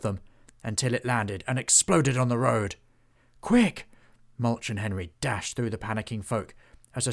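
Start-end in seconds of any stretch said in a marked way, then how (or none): 3.4-3.9 high loud cry to hurry; urgent shout. A narrator's reading. Mulch urging Henry to run through the panic. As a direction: bark it to get them moving.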